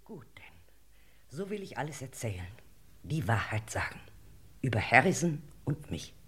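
hushed, breathy whisper; whispered confession. Acted. Widow confessing to the lord in a whisper. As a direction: whisper the truth reluctantly.

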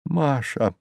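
soft, spent murmur of grief; suddenly fallen voice. A narrator's reading. Cabman naming his dead wife, voice suddenly fallen. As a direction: let the voice drop, all strength gone. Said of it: "внезапно упавшим голосом".

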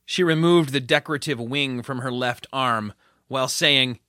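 plain reading voice

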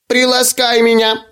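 high squeaky little creature; squeaky doll voice. Acted. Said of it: "проверещал он кукольным голосом". (пискливый кукольный голосок)

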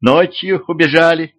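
put-on high, light little child voice; child's voice. A narrator's reading. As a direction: chirp it like a small child. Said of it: "детским голоском откликнулся".

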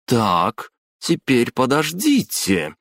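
ominously unhurried; slow, ominous drawl. A narrator's reading. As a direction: stretch each word as a slow warning. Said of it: "медленно и зловеще протянула Майоко".

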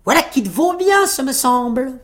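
high-pitched little tailor; tailleur triomphant. A narrator's reading. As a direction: show off, voice pitched right up. Tailleur se vante. (voix perchée et triomphante)

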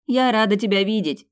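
loud, high, glad shout; shouting back. A narrator's reading. Smiling heroine shouts back to Ethan, loud and high. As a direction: call it out joyfully over a distance. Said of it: "крикнула в ответ".

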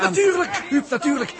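high, excited realisation; light up at the idea; eureka. Acted. Jaap catching Huub's idea about the clock, thrilled.

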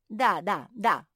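effusive agreement; eager confirmation. Acted. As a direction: insist eagerly, punching each word. Hedgehog eagerly confirms the gift.

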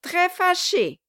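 loud, high sarcastic jeering; loud scorn thrown back at the weeping wife; mocking dwarf. A narrator's reading. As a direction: mimic her scornfully. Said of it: "s'écria le nain".

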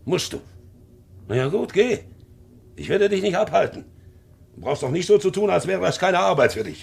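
wounded, loud reproach; irritated outburst. Acted. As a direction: let the hurt come out as sharp reproach. Uncle stung, sends him off.

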